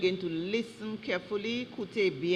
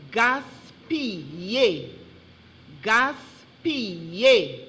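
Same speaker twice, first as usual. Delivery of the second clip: GASPILLER slowly, syllable by syllable; careful dictation; 3 dB louder, slower than usual. Shown as a demonstration. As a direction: stretch out each syllable of "Gaspiller" for a speller. Spelling bee pronouncer gives the word.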